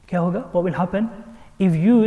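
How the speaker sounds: ordinary delivery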